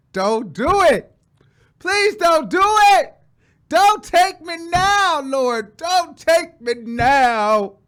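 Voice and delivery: high, slow, wailed plea; hammed-up desperate shouting at God; loud begging. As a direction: cry out to heaven, stretching each word. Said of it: "I was begging. I was pleading".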